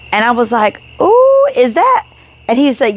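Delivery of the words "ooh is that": high, drawn-out covetous "ooh"; excited. Retold or imitated. Wife retelling spotting a second piece, excited. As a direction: coo it up high, slowly, eyes on a prize.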